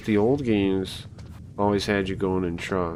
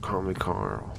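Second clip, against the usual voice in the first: Snake voice; 2 semitones lower, 7 dB quieter, slower than usual. Retold or imitated. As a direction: murmur it like a hardened soldier. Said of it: "Oh, you know, I should do that".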